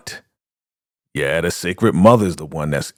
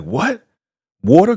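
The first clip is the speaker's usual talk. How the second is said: high-pitched mock surprise; startled; 11 semitones higher, 4 dB louder than usual. Condemned man as the shower comes on, high startled voice. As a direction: shoot the pitch up in disbelief.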